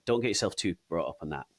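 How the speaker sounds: ordinary talk, nothing marked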